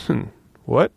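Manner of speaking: normal talking voice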